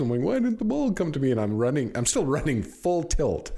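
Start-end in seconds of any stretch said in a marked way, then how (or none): 0.2-1.3 high, baffled self-quote; mock bewilderment, pitched way up; confused. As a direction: sound genuinely puzzled at the empty air. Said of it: "I got all confused".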